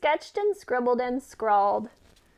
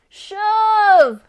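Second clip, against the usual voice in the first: loud, high yell of shove; shouted command; 9 semitones higher, 12 dB louder than usual. Acted. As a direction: bellow it to spur her on. Goat urging hen to shove.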